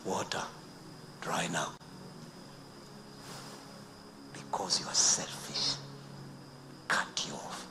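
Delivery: hushed, mostly whispered rebuke; God whispering judgment on the covenant breaker. Acted. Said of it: "He says".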